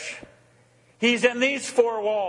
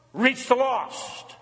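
usual speaking voice

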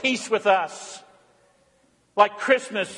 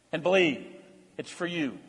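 usual speaking voice

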